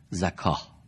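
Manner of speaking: زکاه read with a long a and a final h, zakah; Quran reading. Shown as a demonstration. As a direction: demonstrate زکاه as written. (تلفظ قرآنی زکاه)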